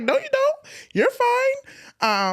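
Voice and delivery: very high mock no you don't; high playful dismissal. Friend telling guest she need not move, high playful no. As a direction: brush it off brightly. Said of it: "she's like".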